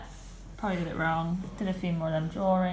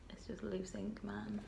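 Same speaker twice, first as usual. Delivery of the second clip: whisper; 12 dB quieter than usual. Real, not acted. Quiet aside while writing.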